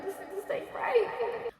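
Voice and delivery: slow high-pitched mock alarm; alarmed realization. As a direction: let it rise high and drag as the alarm sinks in. Panicked realization retold.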